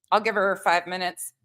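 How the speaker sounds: usual speaking voice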